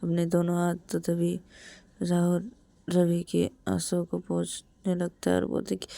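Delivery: usual speaking voice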